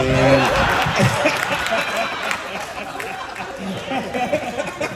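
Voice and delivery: loud, high burst of real laughter. Real, not acted. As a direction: crack up laughing at the joke. Big laugh at joke.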